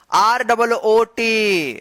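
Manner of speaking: teacher spelling letter by letter for the class; slow spelling. Shown as a demonstration. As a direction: spell each letter out very slowly. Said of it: "क्या पढ़ेंगे इसको".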